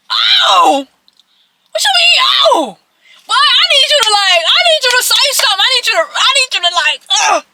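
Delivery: loud, high, exasperated re-enactment; outraged yell. Retold or imitated. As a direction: throw his "oh" back at him in disbelief, yelling high. Outraged reaction to boyfriend's "oh.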